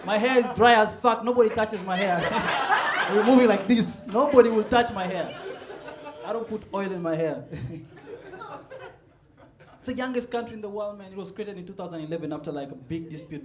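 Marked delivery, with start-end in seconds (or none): none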